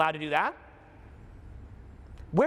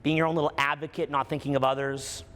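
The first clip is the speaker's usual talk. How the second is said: guided meditation; 4 semitones lower than usual. Acted. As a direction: lead them gently into it.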